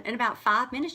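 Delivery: ordinary talk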